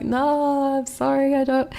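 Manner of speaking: a lightly voiced flustered self; flustered apology. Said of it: "I'm like".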